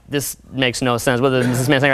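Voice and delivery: a dull grumbling audience member; mock confused listener. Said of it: "you guys are like".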